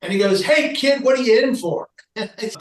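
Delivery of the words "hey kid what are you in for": put-on higher, louder breezy call to the kid; a chaplain calling out to a patient in his room; cheery friar greeting. Friar breezily greets the struggling patient, higher and louder.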